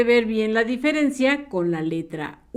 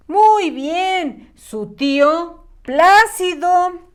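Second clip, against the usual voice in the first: higher, louder, slow and beaming; excited praise; 7 semitones higher, 4 dB louder, much slower than usual. Real, not acted. Teacher praising pupils who answered, excited slow praise. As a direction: reward the answer with big praise.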